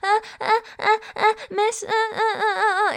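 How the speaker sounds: high, hammed-up whimpering uhs; imitating the guy moaning her name at night; mock moaning.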